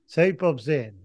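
usual speaking voice

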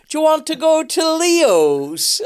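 high, slow Belfast auntie voice; sing-song Northern Irish aunt; Belfast accent. Retold or imitated. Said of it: "she would go".

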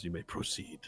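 very soft, subdued official; robed inspector. Acted. Robed man clears Varel after the check, very quietly. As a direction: give quiet permission. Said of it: "then he nods and says".

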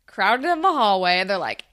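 ordinary talk, nothing marked

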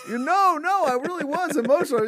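hammed-up indignant Joe voice; mock protest. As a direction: play a hurt friend insisting he's sincere.

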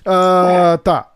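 ordinary talk, nothing marked

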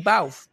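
short imitated bark, bauf; mock dog bark. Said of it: "Tú no puedes decir". (ladrido imitado, corto)

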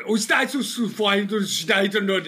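loud, aggressive, high-pitched German gibberish; fake German rant. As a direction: bark mock German to scare someone off. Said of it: "I speak loud and aggressively in a fake German".

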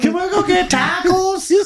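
squeaky, very high put-on plea; silly high voice. Asking friends for tacos in jest, silly high voice. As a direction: ask in a comic squeak.

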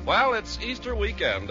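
normal talking voice

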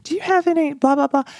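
pitched high, asking a favor; polite neighborly ask. Retold or imitated. New neighbor asking upstairs neighbors for cream, pitched high. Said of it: "I'm like".

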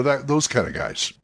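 ordinary delivery

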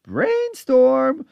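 high sing-song imitation; lilting, high and bouncy; sing-songy voice. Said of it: "in sort of a sing-songy voice".

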